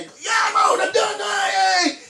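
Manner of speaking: high, drawn-out celebratory cry; mock-excited high squeal of being done; kid gleefully finished first. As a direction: whoop it like a kid racing out of class. Mimics kids rushing to finish first.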